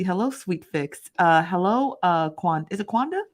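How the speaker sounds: normal talking voice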